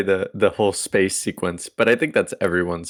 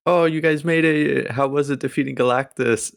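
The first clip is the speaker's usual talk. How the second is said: higher-pitched imitation of an excited crowd; imitated eager crowd; 7 semitones higher than usual. Crowd greeting the heroes when they land back, imitated excitement. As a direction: mimic eager questioners, pitched up. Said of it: "everyone's like".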